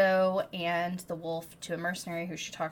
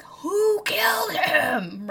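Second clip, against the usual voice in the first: beast's furious demand; 11 semitones higher, 8 dB louder, much slower than usual. Retold or imitated. Beast bursts into her house.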